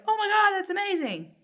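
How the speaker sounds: high, loud delighted gasp of wonder; gushing excitement. Pretend. Flipping through the book, overjoyed at a pattern. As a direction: squeal it like you're thrilled. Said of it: "instead of".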